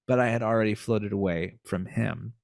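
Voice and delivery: normal talking voice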